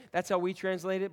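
usual speaking voice

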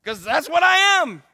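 high, loud whooping yes; delighted shout of yes; excited whoop. Retold or imitated. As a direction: cheer it out proudly. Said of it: "I'm like".

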